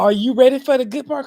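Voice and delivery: high, loud, eager boss voice; imitating excited manager. Mocking his manager who is thrilled about a tiny raise, high and loud. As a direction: play the giddy manager teasing good news. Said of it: "She talking about".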